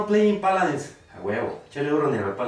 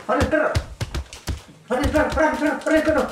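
hyped taunt; 8 semitones higher, much faster than usual. Real, not acted. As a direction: hype yourself up against the opponent. Hyped taunting in boxing game.

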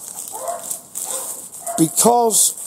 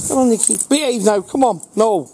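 higher, brisk dog-coaxing voice; 7 semitones higher than usual. Real, not acted. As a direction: chivvy the dog along.

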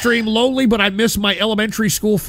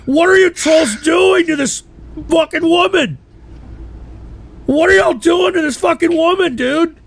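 high-pitched, incredulous exclamation; shocked outcry; 6 semitones higher than usual. Real, not acted. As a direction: cry out in disbelief at the trolls. Shocked outcry after laughing fit.

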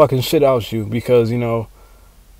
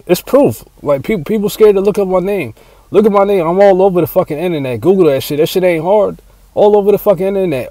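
higher, louder insistence; fired-up bragging; worked-up boast; 6 semitones higher, 3 dB louder than usual. Real, not acted. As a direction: push it, you're proving yourself. Bragging, insisting people look.

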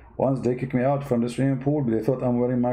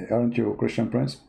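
normal talking voice

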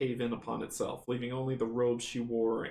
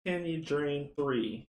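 ordinary talk, nothing marked

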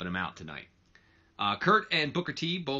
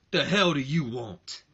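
slow, louder snarl of a question; hostile demand; 4 semitones higher, 5 dB louder, slower than usual. Retold or imitated. Booker standing up to confront Kurt, slow angry growl. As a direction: square up and demand it. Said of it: "He goes".

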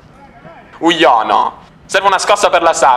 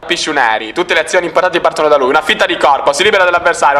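ordinary delivery

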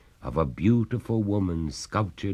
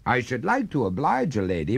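quick, higher, polite reply; courteous; 6 semitones higher, faster than usual. A narrator's reading. Master puts off the freezing lady, courteously. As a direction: politely decline with gentlemanly ease. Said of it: "returned the master courteously".

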